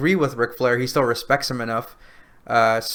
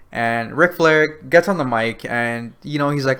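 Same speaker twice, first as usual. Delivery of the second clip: ordinary delivery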